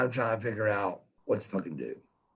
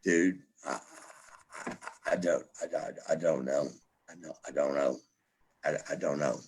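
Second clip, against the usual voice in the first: retold broken-down mumble; 7 semitones lower, 8 dB quieter, faster than usual. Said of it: "I go".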